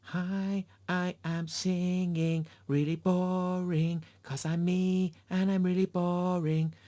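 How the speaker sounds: high, slow sing-song mockery of a dull performer; mocking a boring singer. Reviewer mocking the main actress, high mock drone. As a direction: send up the lead as dreary and self-satisfied. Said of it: "it was just like".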